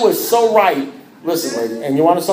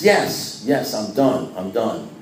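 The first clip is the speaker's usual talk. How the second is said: fading insistence; 7 semitones lower, 4 dB quieter, much slower than usual. Retold or imitated. Exasperated insistence trailing off.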